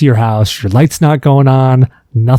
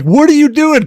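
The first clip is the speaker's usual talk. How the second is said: startled husband; 12 semitones higher than usual. Retold or imitated. Husband bursting in, quoted.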